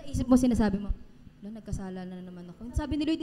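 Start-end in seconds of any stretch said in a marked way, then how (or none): 1.4-2.7 quiet inner voice. Retold or imitated. Inner voice quoted.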